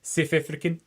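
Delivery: imitated South African accent, clipped vowels on "South African". Shown as a demonstration. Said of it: "I just tend to land on South African".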